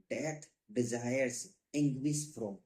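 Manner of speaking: drawn out word by word; slow rubric dictation. Shown as a demonstration. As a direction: read it out for students to copy. Teacher dictating a rubric name.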